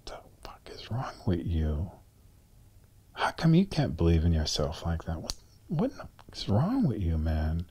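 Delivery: harsh whisper at himself, eyes closed. Retold or imitated. Lying on the floor, angry whisper at himself. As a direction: hiss it under your breath in self-disgust. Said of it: "and I'm like".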